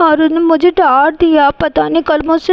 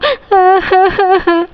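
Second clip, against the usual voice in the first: put-on child sobbing; 5 semitones higher than usual. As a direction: cry like a scolded little girl. Girl crying before parents.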